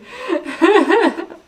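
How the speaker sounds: loud, high peals of laughter; giggling. Real, not acted. Streamer admits watching another channel, high loud giggle.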